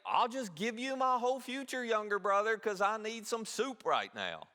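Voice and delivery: high, lightly voiced careless Esau; a hint of a silly hungry brother; mock foolish Esau. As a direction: play the fool trading it all away. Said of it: "who would say".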